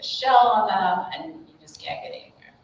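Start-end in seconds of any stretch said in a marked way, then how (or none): 1.1-2.5 drops to a whisper at the end. Real, not acted. Parent coach ending her point on a whisper. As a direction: let the thought fade into a hushed whisper.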